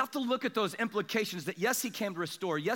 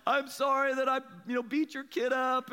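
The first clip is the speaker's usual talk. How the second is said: higher, mock-tearful boy; imitated crying apology; 7 semitones higher than usual. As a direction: apologize like a crying kid, put on. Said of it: "Brandon's crying".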